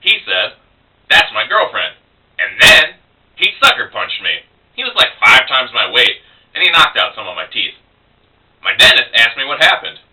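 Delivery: spoken-word poem with punched turning words; comic storytelling verse, paused between phrases; poetry reading. Acted. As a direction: perform the poem in measured phrases with lively pitch swings. Performs own poem.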